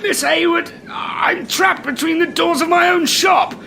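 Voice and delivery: high, tense explanation; strained restraint. Acted. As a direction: keep politeness while being squeezed. Trapped manager in pain, restraining himself.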